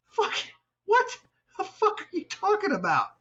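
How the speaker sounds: high, disbelieving cry with a laugh; half-laughing mock rage at the phone; incredulous outburst. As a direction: blurt it out, baffled and laughing.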